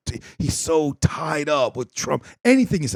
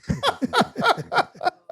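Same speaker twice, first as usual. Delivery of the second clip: high wheezing laugh. Real, not acted.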